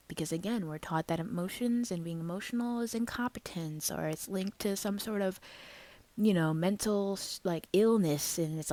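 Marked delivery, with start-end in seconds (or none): none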